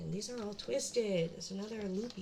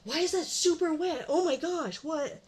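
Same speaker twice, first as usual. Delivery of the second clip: high, loud alarmed yell; loud, high, shocked outcry; 8 semitones higher, 8 dB louder than usual. Real, not acted. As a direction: shout it out in disgust at the wet spot. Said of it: "I'm sorry I'm yelling".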